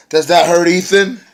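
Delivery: loud, high, sneering taunt; mocking taunt. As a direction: rub it in his face, mockingly loud. [name] taunting a beaten rival.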